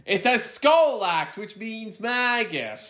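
high, slow pronouncement; hammed-up preacher. Podcaster quoting a hell film, hammed-up preacher. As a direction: draw each word out high and dramatic.

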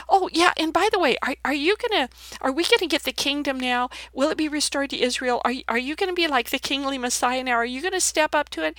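high-pitched, pestering string of questions; eager disciples. Retold or imitated. Disciples asking Jesus about the kingdom, high and eager. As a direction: imitate eager followers tumbling over their questions. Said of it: "and then they go".

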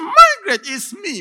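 very high, very loud shout of her name; crying shout. Acted. Husband begging his wife to open, crying shout. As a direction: shout in anguish. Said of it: "he was shouting".

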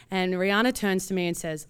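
ordinary talk

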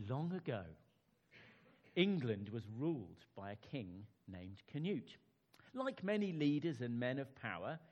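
storyteller voice for a bedtime tale; children's story narration. Acted. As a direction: read it like sitting kids down for a story. Reads children's story aloud.

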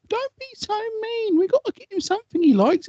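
hammed-up wife pleading the child's case; imitated reproachful wife. Acting wife's reproach in argument.

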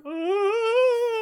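very high put-on squeal; imitated giddy cry; mock squeal. Meryl running up to Roberto, very high mock squeal. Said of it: "runs up going".

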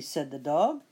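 ordinary delivery, nothing marked

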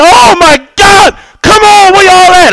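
high, loud, drawn-out yell for help; hammed-up frantic holler; retold panicked screaming. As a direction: scream desperately for the family to come. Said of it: "now I'm screaming".

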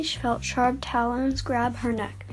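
usual speaking voice

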